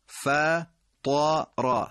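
فطر drawn out extremely slowly; very slow recited فطر; recited model. Shown as a demonstration. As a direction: chant فطر with the ta full. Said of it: "اون کدام کلمه است".

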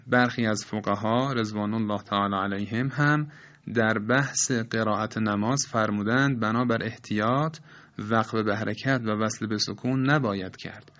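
usual speaking voice

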